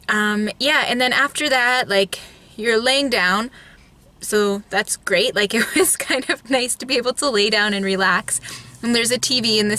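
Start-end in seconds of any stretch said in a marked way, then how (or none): none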